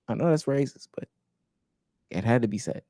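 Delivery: normal talking voice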